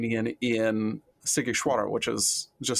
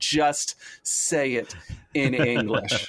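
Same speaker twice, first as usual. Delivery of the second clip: slow, drawn-out mock exasperation; exasperated insistence; slower than usual. Fast food worker to struggling learner, slow mock exasperation. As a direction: imitate someone fed up, stretching each word. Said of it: "And they're like".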